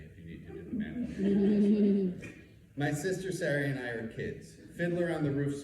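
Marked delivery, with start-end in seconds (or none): 0.0-2.8 louder, slowed, laughing. Real, not acted. Storyteller joking about his age, laughing. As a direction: laugh through the aside. Teller laughing at own joke.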